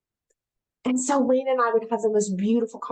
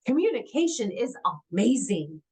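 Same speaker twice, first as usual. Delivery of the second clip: ordinary talk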